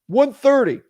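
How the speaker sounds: high, loud blurted counteroffer; put-on high seller voice; imitated seller. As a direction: throw out a price as the seller. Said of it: "maybe they say".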